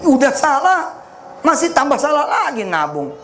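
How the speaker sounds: high exasperated cry; exasperated exclamation. Real, not acted. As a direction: throw up your hands at the mistake. Preacher exclaims at wrong saving.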